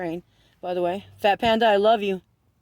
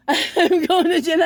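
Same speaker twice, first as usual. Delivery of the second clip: laughing; 6 semitones higher, 3 dB louder, slower than usual. Real, not acted. Laughing at his own story.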